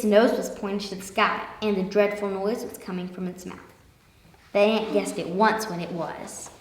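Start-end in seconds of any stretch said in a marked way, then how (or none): none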